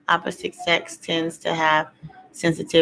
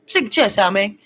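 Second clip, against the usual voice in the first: higher, louder mock tough-guy dad; a dad toughening up his crying son, higher and louder; 9 semitones higher, 5 dB louder than usual. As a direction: bark it like a macho dad telling his son not to cry. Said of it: "the dad gonna be like".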